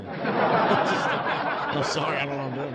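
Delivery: slow, laughing apology. Real, not acted. To listeners about being funny, laughing. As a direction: giggle through the sorry.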